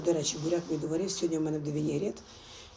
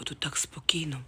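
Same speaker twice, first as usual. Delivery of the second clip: whisper. Real, not acted. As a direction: whisper so nobody wakes. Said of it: "А чого я тихо говорю?".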